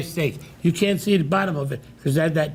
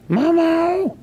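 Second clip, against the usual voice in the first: mother yelling; 13 semitones higher, much slower than usual. Retold or imitated. Mother calling her son from the back. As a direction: call him from the front of the shop. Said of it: "she'd yell out".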